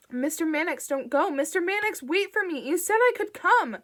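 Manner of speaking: high, loud, panicked begging; frantic plea called after the car; desperate pleading. A narrator's reading. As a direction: beg him not to leave you behind. Bing begging as car leaves.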